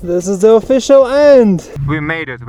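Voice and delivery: loud, jubilant summit cheer; triumphant shouting. Real, not acted. Finishing the via ferrata.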